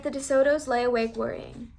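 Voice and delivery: plain reading voice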